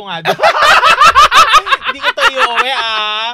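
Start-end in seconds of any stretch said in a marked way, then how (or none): 1.9-3.3 high, loud laughing protest; laughing denial. Real, not acted. Host denying to co-host that she takes the kit home, laughing loudly.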